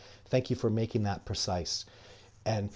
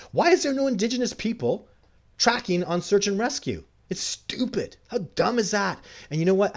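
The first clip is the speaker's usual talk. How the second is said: much higher, louder indignant outburst; angry rant; 10 semitones higher, 4 dB louder than usual. Real, not acted. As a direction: let the outrage spill over at the officials. Ranting about search and rescue.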